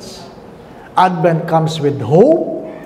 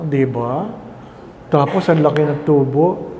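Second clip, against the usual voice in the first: normal talking voice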